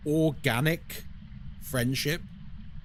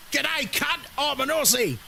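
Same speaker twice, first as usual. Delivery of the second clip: much higher, cartoonish Australian accent; mock Australian accent; 10 semitones higher than usual. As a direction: ham up an Aussie greeting in an Australian accent. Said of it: "that's how they talk".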